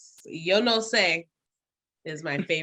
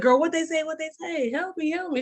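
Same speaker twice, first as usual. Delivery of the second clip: higher, mock-frantic pleas for help; retold panic; 9 semitones higher than usual. Retelling Spanish speakers confusing her, mock-frantic plea. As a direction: beg your friend to translate, half laughing. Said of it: "I was like".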